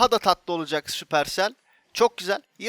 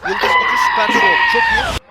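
çok yüksek perdeden, bağırarak coşku; tiz sevinç çığlığı; 10 dB louder than usual. Real, not acted. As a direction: sesi tepeye fırlatıp haykır. Yıldız gücü çıkınca tiz çığlık. (tiz ve gür sevinç çığlığı)

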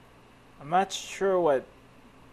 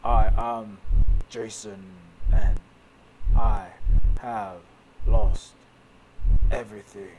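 lower, louder anguished man; dramatic hero voice; 5 semitones lower, 7 dB louder than usual. Acted. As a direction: ask it like a lost, grieving man. Voicing Jason, lost hero.

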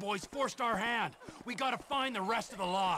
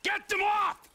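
sudden shout; 11 semitones higher, slower than usual. Acted. Trevor humiliating the biker, sudden shout. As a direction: bark the order at him.

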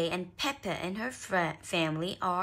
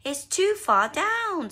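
Mummy Rabbit apologising; 9 semitones higher, 8 dB louder than usual. A narrator's reading. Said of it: "said Mummy Rabbit".

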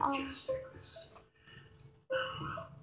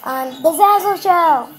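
loud show-host announcement of the title; louder, announcing the show; announcer flourish; 4 semitones higher, 8 dB louder than usual. Acted. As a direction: belt out the show name like a TV host. Announcing her show's name.

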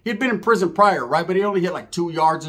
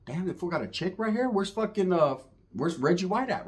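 a touch of a hush; 7 dB quieter than usual. Retold or imitated. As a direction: keep it hushed in the dark room. Whispering in dark house.

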